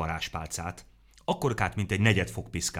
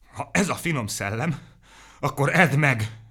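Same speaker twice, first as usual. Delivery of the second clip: groaning with effort; 7 semitones higher, 5 dB louder than usual. A narrator's reading. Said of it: "nyögte neki izzadva".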